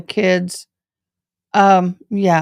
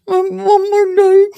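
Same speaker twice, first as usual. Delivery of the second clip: pleading mom; 13 semitones higher, 4 dB louder than usual. Retold or imitated. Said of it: "I was like".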